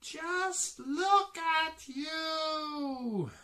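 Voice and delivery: very high, loud, drawn-out shriek; screaming. A narrator's reading. Missus Wishy-Washy scolds the muddy animals, high slow scream. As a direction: scream it out slowly at full pitch. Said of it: "she screams".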